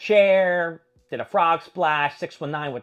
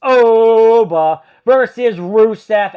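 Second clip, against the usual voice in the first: much louder, slow drawn-out announcer call; ring announcer; 5 semitones higher, 13 dB louder, much slower than usual. Pretend. Vlogger announcing the final match, big ring announcer call.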